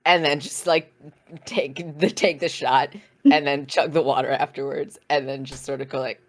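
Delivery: ordinary talk, nothing marked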